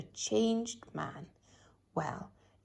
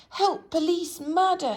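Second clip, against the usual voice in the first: much higher, much louder cry for help; scream; 10 semitones higher, 9 dB louder than usual. A narrator's reading. Mister Jelly screams when a leaf lands on him. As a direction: yell for the police. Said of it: "he screamed".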